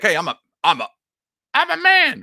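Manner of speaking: stammered start bursting into high, loud declaration; mock announcement. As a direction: caricature someone loudly announcing themselves, pitch leaping up.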